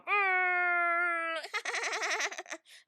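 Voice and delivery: long, loud, high cackle; theatrical cackle. Retold or imitated. Fan imitating the rapper's alter ego, loud high cackle. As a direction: hold a high note breaking into a staccato cackle.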